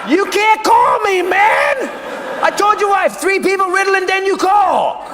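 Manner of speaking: hammed-up frantic yell at the phone; panicked shout. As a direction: blurt it out in shocked alarm. Panicked shout at Bruce's call.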